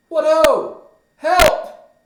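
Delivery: very high, loud cry for help; alarmed shouting. Acted. Polonius behind the arras calls for help, high shout.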